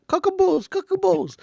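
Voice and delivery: high-pitched silly shout; comic yelling. Pretend. Reader repeats the outburst for a gif, high comic yell. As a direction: yell it high, on the edge of laughing.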